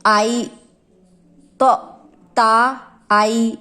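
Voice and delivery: ता and आई drawn out very slowly, each sound held; slow suffix demo. Shown as a demonstration. Teacher spelling out suffixes for students, slow and drawn. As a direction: stretch ता and आई out syllable by syllable for the class. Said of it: "Pratyaya".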